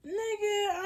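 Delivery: high-pitched, drawn-out protest; mock disbelief. Nervous smoker answering a friend who says chill, mock disbelief. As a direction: pitch it way up in comic exasperation.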